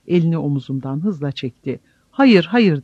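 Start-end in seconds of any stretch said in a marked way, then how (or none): 2.1-2.8 high, loud repeated cry; loud, harsh insistence; shouted refusal. A narrator's reading. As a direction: refuse hard, raising your voice. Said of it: "diye bağırdı yeniden".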